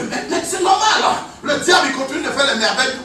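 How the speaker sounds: loud, driving preacher's cadence with laughs; preaching at full force. Acted. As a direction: hammer the point home to the congregation. Sermon rising against the devil.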